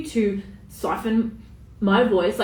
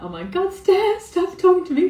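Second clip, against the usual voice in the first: mock protest leaping high and louder; imitated listener protest; 10 semitones higher, 3 dB louder than usual. Imagined listener telling the host to stop, high mock protest. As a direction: play a listener begging her to stop, pitch shooting up. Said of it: "you're gonna be like".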